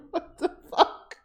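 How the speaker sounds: breathless high laugh through the words; laughing disbelief. Real, not acted. Watching the character throw the paper, gasping with laughter. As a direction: wheeze it out mid-laugh.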